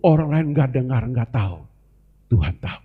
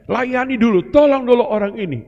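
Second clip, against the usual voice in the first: raised, higher urging voice; urgent exhortation; 9 semitones higher, 4 dB louder than usual. Acted. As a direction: urge them to help first, pitched up and forceful. Urges helping before asking questions.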